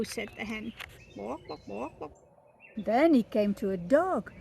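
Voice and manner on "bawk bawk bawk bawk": soft hen clucks; clucking hen. Acted. The hen answering the baby bird with soft clucks.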